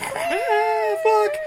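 very high falsetto yelp; mock pain screech. Podcaster acts out the killer being clawed, mock pain screech. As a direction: squeal as if being clawed, hammed up.

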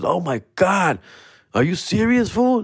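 high disbelieving gasp; can't believe your luck, voice way up; stunned excitement. Retold or imitated. Stunned at big news.

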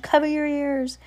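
high urgent warning; urgent cry. Acted. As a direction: order them urgently, high voice. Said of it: "cried the judge".

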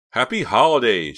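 high, loud breezy send-off; cheerful. A narrator's reading. Reva taunts Hank as she leaves, cheerfully. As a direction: sing out the greeting gleefully. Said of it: "she said cheerfully".

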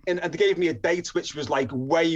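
ordinary delivery

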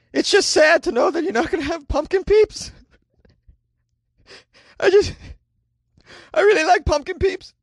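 high, whiny mock-tragic lament; mock sobbing whine. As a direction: wail like the world is ending, hammed up. Whining over lost pumpkin Peeps.

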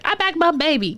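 put-on high voice of a mom defending her child; mock proud mom. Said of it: "my mom will do some wild shit just to be like".

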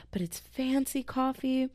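a hint of a snooty customer; mock snooty defense. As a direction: play the snob justifying a pricey drink. Mock defending fancy coffee.

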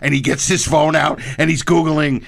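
ordinary talk

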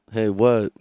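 ordinary talk, nothing marked

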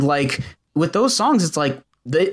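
usual speaking voice

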